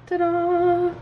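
ta-da reveal. Real, not acted. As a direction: present the cupcake like a little magic trick. Shows the cupcake out of pan.

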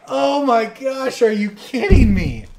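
high, loud, slow oh my gosh; hammed-up disbelief at being called out; mock outrage. Called out on Reddit, he wails in mock outrage. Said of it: "I was just like".

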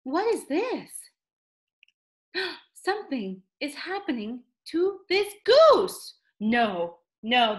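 usual speaking voice

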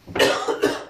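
short dry cough; a last dry hack; coughing fit. Real, not acted. After cinnamon, coughing again.

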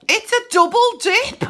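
high, loud delight; excited. Real, not acted. Finding a double win on the card, excited. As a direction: squeal it with joy.

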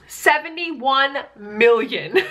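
slow, drawn-out amazement; Seventy-one million, stretched out slowly; stunned disbelief. Real, not acted. Amazed at the read count.

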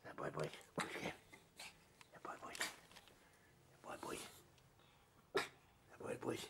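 hushed playful whisper. Real, not acted. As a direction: whisper teasingly to the dog. Quiet playful whisper to dog.

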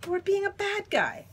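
put-on high-pitched child's scolding; indignant accusation. Leo scolds Stillwater over the cookies, indignant. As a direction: push the reproach up high.

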